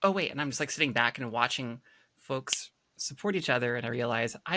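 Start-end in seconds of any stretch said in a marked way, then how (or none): 0.0-0.3 higher-pitched quoted reaction; imitated onlookers' reaction. Onlookers seeing the speaker start typing, imitated reaction. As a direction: lightly voice a watcher catching themselves. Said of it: "and you'll be like".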